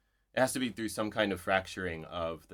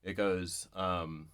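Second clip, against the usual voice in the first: normal talking voice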